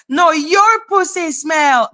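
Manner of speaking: lightly put-on bratty retort; mock childish comeback. Streamer throwing back a childish insult at haters, mock taunting.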